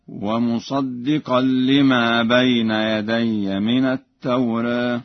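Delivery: slow chant, final vowel of «التوراة» tilted toward e; Warsh taqlil. Shown as a demonstration. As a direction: recite it drawn out, «التوراة» nearly "tawreeh". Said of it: "بتقليل الألف". (تلاوة ورش بتقليل ألف «التوراة»)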